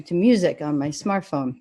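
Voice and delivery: usual speaking voice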